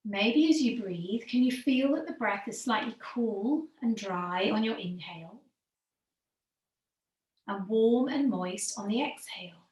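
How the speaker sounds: calm yoga teacher easing the class into stillness; guided meditation. Acted. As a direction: guide them gently into the breath, letting phrases sink at the end. Leads breathing meditation.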